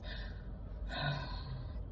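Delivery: long unvoiced sigh before answering; deep breath out. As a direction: draw a deep breath, then let it go. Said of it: "she takes a deep breath".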